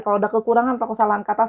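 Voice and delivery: normal talking voice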